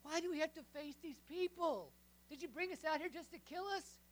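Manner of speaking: loud, high griping at Moses; whiny complaint. Acted. Israelites griping at Moses in the wilderness, loud whiny complaint. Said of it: "they complain".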